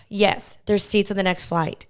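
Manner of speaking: grudging answer after a heavy sigh; sigh, then give in unwillingly; reluctant. Retold or imitated. Gate agent who didn't wanna tell, reluctant. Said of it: "She, like, reluctantly".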